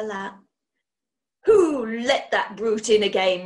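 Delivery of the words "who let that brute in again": louder, furious outburst; raging. A narrator's reading. Mother rages at the dog being let in. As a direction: spit it out in a rage. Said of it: "raged Miss Boothroyd".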